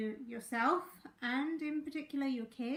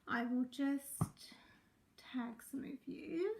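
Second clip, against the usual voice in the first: soft unvoiced whisper; 3 dB quieter than usual. Real, not acted. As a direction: whisper it to yourself. Aside while tagging viewers.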